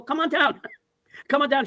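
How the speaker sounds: retold call to someone on the stairs, lifted pitch; mock welcoming call with a laugh; calling downstairs.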